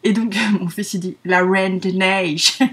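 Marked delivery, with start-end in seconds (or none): 1.2-2.6 fake English accent. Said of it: "il le répète avec un accent, euh, anglais".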